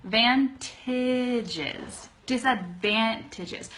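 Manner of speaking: slow and segmented; teacher sounding out each chunk; slow syllable breakdown. Shown as a demonstration. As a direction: model the word in careful parts. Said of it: "That's saying it slowly".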